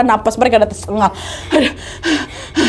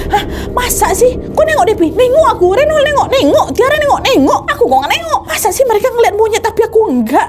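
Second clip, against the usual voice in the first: incredulous; 11 semitones higher than usual. Retold or imitated. Meli disbelieving the monkey story.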